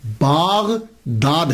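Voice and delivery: बागदाद said slowly with a long aa in the first syllable; the old form बागदाद with the long first vowel; original pronunciation. Shown as a demonstration. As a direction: stretch it out as बागदाद, Baa-gdaad. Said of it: "इसका pronunciation बागदाद".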